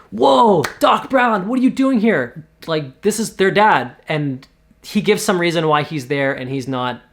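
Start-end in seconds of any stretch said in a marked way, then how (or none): 0.1-2.5 high, loud gasp of disbelief; like seeing a ghost, pitched way up; shocked surprise. Acted. Kids stunned to find their dad alive, high surprised cry.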